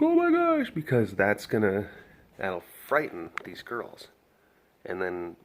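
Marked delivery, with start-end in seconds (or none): 0.0-0.7 much higher, louder squealed gasp; mock scream. Showing forbidden excitement, mock shriek. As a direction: shriek it like a startled fan. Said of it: "We can't yell or scream".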